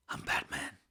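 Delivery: hushed low Batman whisper; Keaton Batman whisper. Retold or imitated. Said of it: "An improvised line, by the way, by Keaton".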